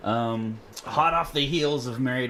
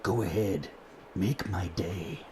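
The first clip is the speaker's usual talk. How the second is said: Dirty Harry line; 3 semitones lower, 4 dB quieter than usual. Retold or imitated. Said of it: "This one is".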